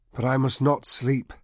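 mild protest. A narrator's reading. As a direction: protest against sleep, pitch lifted. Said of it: "Alder protested".

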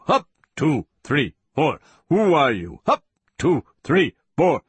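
high, rhythmic marching orders; drill count. Acted. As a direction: call the cadence like a drill sergeant. Captor counts out forced march.

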